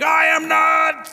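high, loud, slow shouted denial; mock-fierce John the Baptist; confrontational shout. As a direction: bark it back at them. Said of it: "confrontational voice".

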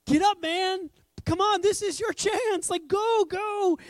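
high, loud cheering-on; excited crowd. Acted. Crowd cheering on a blind beggar, excited. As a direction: urge him up like a thrilled onlooker. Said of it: "Everyone's excited on his behalf. Everyone's like".

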